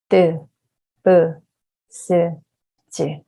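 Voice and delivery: each consonant spaced out and distinct; slow and clear. Shown as a demonstration. Teacher reading consonants aloud for students, slow and clear. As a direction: read slowly, one by one, clearly. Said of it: "let's read the consonants slowly but clearly".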